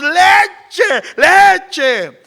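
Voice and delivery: high, loud tantrum shout; imitated frantic child's scream; desperate toddler yelling. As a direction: yell it like a child demanding milk. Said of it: "a los gritos desesperados".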